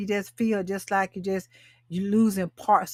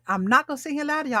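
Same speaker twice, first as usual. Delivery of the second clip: quick aside; 6 semitones higher, much faster than usual. Real, not acted. Fast confessional aside.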